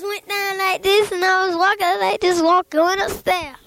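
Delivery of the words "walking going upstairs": ordinary delivery, nothing marked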